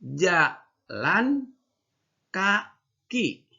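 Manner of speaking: "Jalan" rising, "kaki" high then falling; two words, spaced, for kids to echo; model for repeating. Shown as a demonstration. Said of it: "Yuk, bawahnya".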